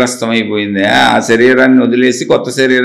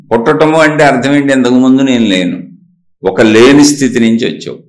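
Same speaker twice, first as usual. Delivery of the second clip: ordinary delivery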